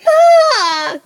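loud, high, astonished shout; drawn-out amazed call; overjoyed cry. Acted. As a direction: cry out at seeing her alive. Jack amazed to see mother.

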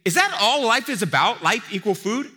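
loud, high challenge; Jesus voiced as a loud, high challenge; challenging question. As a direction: push them with the question. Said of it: "His other framing would be saying".

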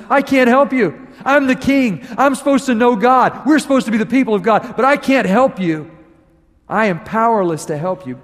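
high, loud helpless plea; desperate king. Retold or imitated. King pleading with Naaman, loud and high. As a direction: cry out as a powerless king.

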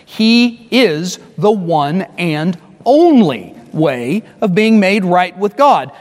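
"only" stretched slowly with a rise; slow down and lean on "only"; emphatic. Real, not acted. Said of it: "let's just be really emphatic".

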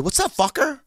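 high-pitched mock greeting; imitated Rogan. Rogan greeting him outside the store, high put-on voice. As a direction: shoot it out high, imitating a cocky pal.

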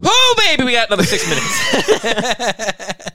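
very high, slow, laughing cheer; excited cheer. Real, not acted. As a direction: whoop it out in mock delight. Joking about time left.

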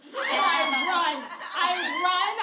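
very loud, high, drawn-out cry; hammed-up panicked shout. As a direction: yell it with comic desperation. Acts out fleeing after the kiss.

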